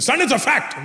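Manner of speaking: put-on high Indian-accented father, asserting; imitated dad's Indian accent; insistent Indian dad. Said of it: "My dad goes".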